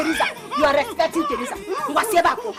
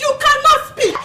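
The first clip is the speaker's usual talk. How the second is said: mocking shout; 8 semitones higher, 8 dB louder than usual. Mocking quarrel, laughing shout.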